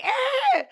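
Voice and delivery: short high-pitched squeal; mock scream. Imitating a lady cowering from a bat, mock scream.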